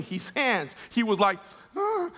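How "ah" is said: put-on high little toddler panic squeal; imitated freak-out. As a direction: mimic a baby freaking out in a high voice. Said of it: "he was freaking out".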